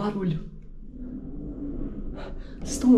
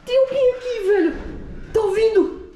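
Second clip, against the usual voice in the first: alarmed. Real, not acted. Alarmed, someone is there.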